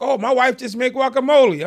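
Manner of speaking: high and loud, proud host; excited offer. Retold or imitated. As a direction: show off the dish eagerly. Friend offering guacamole eagerly.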